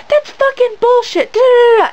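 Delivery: hammed-up outraged complaint; mock angry yelling. Imitating a mom berating event staff, mock angry yelling. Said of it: "she's, like, yelling at this guy".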